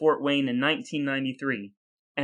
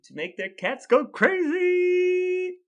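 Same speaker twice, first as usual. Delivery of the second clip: silly voice; 14 semitones higher, slower than usual. Pretend. Joking answer in silly voice.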